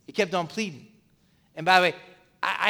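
usual speaking voice

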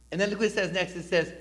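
usual speaking voice

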